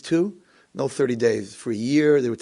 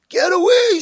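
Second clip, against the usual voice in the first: high, loud acted scream; 6 dB louder, slower than usual. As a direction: drive him off with a high yell. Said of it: "screaming at him".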